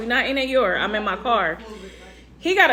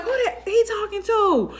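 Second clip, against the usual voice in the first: indignant outrage; 10 semitones higher than usual. Retold or imitated. Outraged at man's insult.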